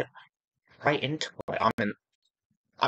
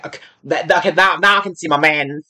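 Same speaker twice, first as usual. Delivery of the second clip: ordinary talk, nothing marked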